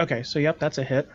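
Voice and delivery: ordinary talk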